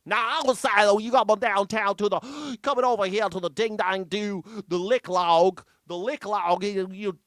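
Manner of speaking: high, loud exaggerated Southern accent; mocking Southern drawl. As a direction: caricature the senator's folksy Southern talk. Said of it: "he doesn't talk like this".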